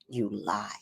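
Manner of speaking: low, quiet flat accusation from the grandson; grandson's retort. Retold or imitated. Grandson calling grandma out, low and quiet. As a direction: catch her out in a low voice. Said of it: "that little son of a gun said to me".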